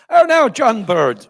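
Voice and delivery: loud, high, slow TV host greeting; mock-welcome the guest grandly; imitated gushing interviewer; effusive host. Imitating TV host's hearty welcome.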